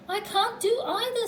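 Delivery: high-pitched wail; high voice of mild panic; dismayed. A narrator's reading. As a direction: wail helplessly at being stuck. Pooh stuck both ways.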